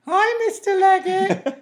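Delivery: put-on high, slow sing-song kids' greeting; drawn-out classroom chorus; kids chorus.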